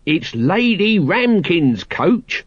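sing-song character protest; Nobby's voice. A narrator's reading. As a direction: point it out with drawn-out words. Nobby's character voice, correcting Colon.

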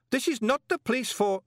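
panicking banker; flustered protest. Acted. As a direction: try to protest, then cut off. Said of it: "Greeningham tried".

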